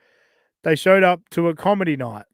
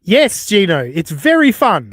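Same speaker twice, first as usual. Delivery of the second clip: sarcastic; 3 semitones higher, 8 dB louder than usual. Real, not acted. Sarcastic reply to the accuser.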